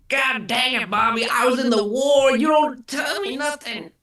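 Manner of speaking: cranky, very high shouted cartoon voice; Cotton. Veteran scolding Bobby about the war, cranky impression. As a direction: rant loudly in a high-pitched character voice. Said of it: "Cotton would have me dying".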